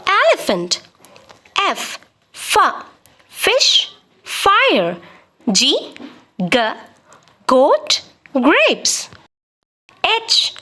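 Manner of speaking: letter, its sound, then two example words, each with a pause; slow, clear read-through for young children; teacher sounding out the alphabet chart; phonics chart drill. Shown as a demonstration.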